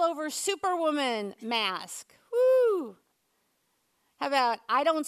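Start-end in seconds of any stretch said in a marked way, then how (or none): 2.3-3.0 high, loud celebratory whoop; excited whoop. Pretend. Preacher showing off a mask, high excited whoop. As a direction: whoop like a proud superwoman.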